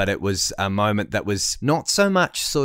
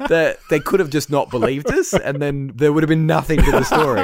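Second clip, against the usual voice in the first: laughing; 6 semitones higher, 5 dB louder than usual. Real, not acted. Laughing while talking.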